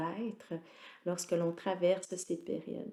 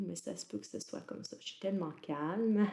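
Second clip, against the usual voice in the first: ordinary talk, nothing marked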